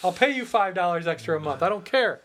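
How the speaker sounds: high mocking pushover voice; mock neighbor. Mocking the one guy who'd pay extra, high. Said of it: "the one guy that's like".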